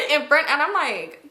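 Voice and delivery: normal talking voice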